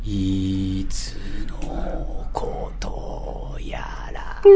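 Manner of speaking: whisper. Acted. Whispered jingle.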